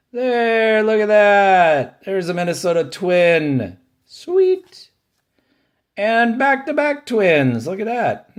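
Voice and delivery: high, loud delighted squeal over the pull; thrilled, voice jumping up high; real delight at a favorite team card; excited. Real, not acted. Excited pull of Twins cards.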